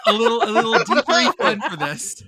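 laughing through the words. Real, not acted. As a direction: giggle out the announcement. Lacht tijdens aankondiging.

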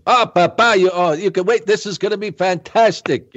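put-on high, gushing excited old booker; Pat Patterson impression. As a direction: squeal it like a thrilled promoter pitching an idea. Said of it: "like Pat Patterson saying".

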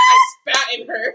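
high, loud mocking quote. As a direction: squeal it out mocking him. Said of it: "and you're like".

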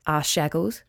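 ordinary delivery

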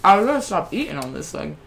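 talking while chewing; words round a bite of food; mouth full. Real, not acted. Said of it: "while you're chewing".

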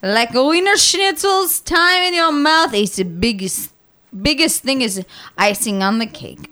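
mock-German pitch with a drawn-out yelp; German accent. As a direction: announce it in hammed-up German. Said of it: "do this in a German accent".

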